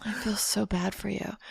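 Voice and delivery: imitated soft, pitying murmur; mock hushed condolence; hushed sympathy. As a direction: say it very softly, like a sympathetic doctor. Repeats doctor's hushed sympathy.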